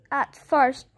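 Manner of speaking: ordinary delivery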